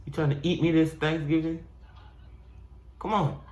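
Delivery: dub the bird like a cartoon; voicing a turkey. Voicing animals in video.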